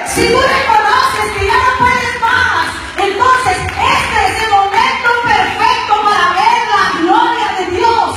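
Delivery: loud, high, fervent sermon climax; rousing pulpit cry about the Holy Spirit; full-force preaching. Acted. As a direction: preach it out over the music, pushing hard.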